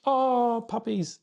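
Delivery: very high-pitched squeal of delight; loud high "oh", adoring; delighted cooing. Real, not acted. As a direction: gush over cute puppies in a high voice. Spots puppies while talking live.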